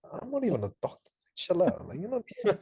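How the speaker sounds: high, laughing disbelief; incredulous at your own cheek; mock self-scolding. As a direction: tell yourself off, cracking up. Mocking own overeager email, laughing.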